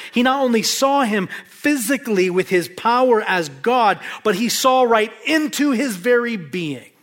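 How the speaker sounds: building to high, driving pulpit crescendo; impassioned preaching. Real, not acted. Preacher telling congregation how deeply Jesus saw Nathanael, impassioned preaching.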